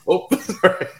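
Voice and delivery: high, giggly apology; laughing. Retold or imitated. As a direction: laugh through a sheepish sorry. Caught spilling beer on bag.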